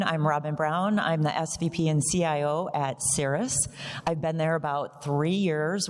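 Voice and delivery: usual speaking voice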